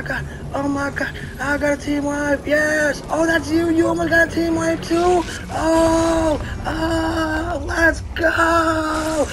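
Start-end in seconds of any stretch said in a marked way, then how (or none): 5.5-9.3 crying out in joy; sob-cheer after the big win; tearful cheer. Real, not acted. Celebrating a team wipe.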